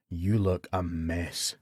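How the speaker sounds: low, slow, soft inner voice; a dry inner voice undercutting him; voice in his head. A narrator's reading. His inner voice mocks the battered face in the mirror, low and slow. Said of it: "said the voice in his head".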